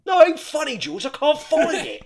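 high, loud whining complaint; whiny dad voice. Retold or imitated. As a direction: whine it like a sulky dad. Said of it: "he went".

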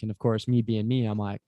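usual speaking voice

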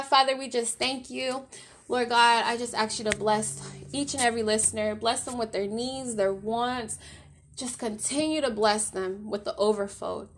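soft fervent closing prayer. Acted. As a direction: pray over the listeners, fading toward the amen. Prays over the listeners.

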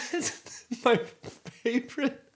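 high breathless laughter between words; can barely speak for laughing. Real, not acted. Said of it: "I need you to stop making me laugh".

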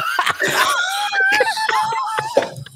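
high, cracking-up laugh; real helpless laughter. Real, not acted. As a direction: burst out laughing at your own slip. Cracking up over his slip.

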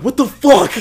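high, loud laughing yell of fright; startled yell. Retold or imitated. Retelling waking to the sergeant's red face, startled laughing yell. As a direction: shriek it, half laughing.

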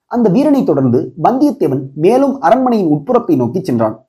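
usual speaking voice

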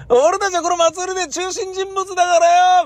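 high, loud swaggering brag; boastful festival guy. Retold or imitated. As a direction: crow about running the festival. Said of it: "みたいな感じなことを".

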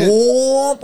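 high whooping police siren; sing-song siren whoop; siren imitation. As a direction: mimic a cop siren going past. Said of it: "it was just like".

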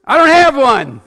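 high, loud holler with a laugh. Retold or imitated. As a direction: yell it back, half laughing. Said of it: "And I hollered back".

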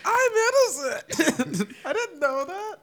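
laughing. Pretend. Mock outrage at Disney lie.

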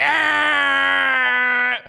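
a sharp high yell; startled high shout; sudden shout. Real, not acted. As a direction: yelp out loud. Replayed clip shout.

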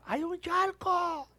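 high, drawn-out call of warning; a rider yelling a warning down the hill; warning shout. Retold or imitated. Someone shouts a warning downhill.

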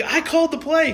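high, indignant mock scolding; angry coach. Coach scolding team during timeout, high indignant mock scold. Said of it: "Our coach was livid".

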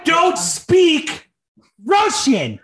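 high, loud mock outburst; shouted movie quote. Co-host quoting a villain after Justin Hammer talk, mock shout. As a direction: punch out each word in a high shout.

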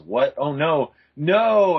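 hammed-up horrified heroine; acted horror. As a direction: build from shock to a big drawn-out no. Voices horrified Sheila in the comic.

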